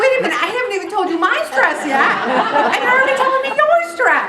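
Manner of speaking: an outraged squeal of protest; mock indignation. Indignant reply to interrupting friend.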